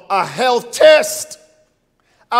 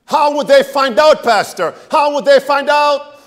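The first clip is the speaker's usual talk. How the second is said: loud questioning; 5 semitones higher, 5 dB louder than usual. Real, not acted. Preacher asks pointedly.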